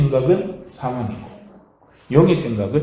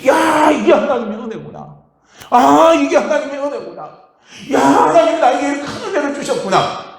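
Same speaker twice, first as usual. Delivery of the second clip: joyful realization; 8 semitones higher than usual. Retold or imitated. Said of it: "은혜를 깨닫게 하시는 거예요".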